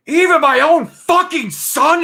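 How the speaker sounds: high, loud, drawn-out scream; full-voiced imitation of an angry father; retold screaming. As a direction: scream it in outrage, imitating him. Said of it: "he just starts screaming".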